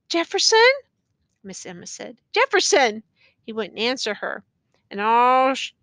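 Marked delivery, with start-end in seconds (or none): 0.1-0.7 high, rising, loud call of a name; pleading call. A narrator's reading. Woman calling unresponsive prisoner. 2.3-3.0 high name call with a firm falling end; insistent call. A narrator's reading. Woman calling unresponsive prisoner again.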